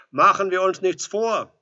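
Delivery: high, very loud retort; loud reproach. A narrator's reading. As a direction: call the reproach through the door. Visitor accuses through the door.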